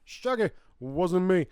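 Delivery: high, loud shout-out; mock song catchphrase. Host quoting [name]'s hit song, loud mock catchphrase. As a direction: call it out like a record's hook.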